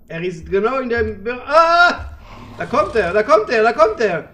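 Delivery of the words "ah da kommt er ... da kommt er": loud scared repeating; panicked. Real, not acted. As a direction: cry out in fright. Alien arrives, panic.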